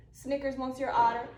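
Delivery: usual speaking voice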